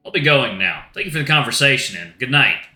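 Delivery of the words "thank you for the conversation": normal talking voice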